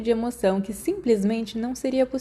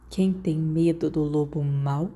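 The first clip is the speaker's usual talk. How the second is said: menacing monster; 4 semitones lower, slower than usual. Acted. Monster taunting in child's nightmare.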